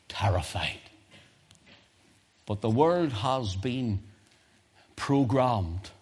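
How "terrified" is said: a single word whispered without voice. Real, not acted. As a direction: let the word drop to a breath. Hushed word in sermon.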